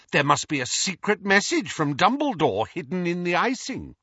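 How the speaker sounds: high-pitched, exaggerated sarcasm; mock the theory as a grand conspiracy; derisive. A narrator's reading. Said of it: "Hermione laughed derisively".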